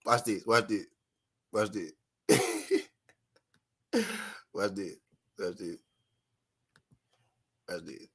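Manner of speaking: repeated teaser fading out; fading to a whisper. Acted. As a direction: let it sink and die away, mock dramatic. Repeats phrase, fading away.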